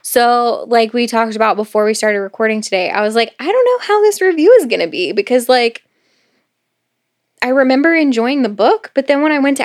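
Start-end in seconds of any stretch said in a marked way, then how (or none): 3.4-5.1 fast, high-pitched fretting; quick, high mock worry; worried self-quote. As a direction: quote yourself doubtfully in a raised voice. Quotes own worry before recording.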